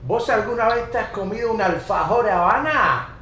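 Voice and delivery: playful rioplatense voseo; Argentinian accent. Retold or imitated. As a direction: sing it out like a porteño. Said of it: "how the Argentinians talk".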